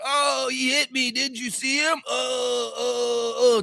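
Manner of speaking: high, drawn-out whiny moaning; mock whining. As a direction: imitate a player whining about being hit. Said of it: "It's not whining to the rest".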